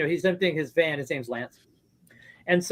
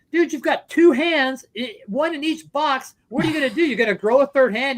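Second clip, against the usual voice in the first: mocking retort; 8 semitones higher, 3 dB louder than usual. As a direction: drag it out high and sneering at the two-handed hoarder. Retold quarrel over record boxes.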